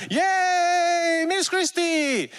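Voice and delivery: high, slow, mock children's cheer; kids cheering. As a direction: cheer like excited schoolkids. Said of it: "the kids are like".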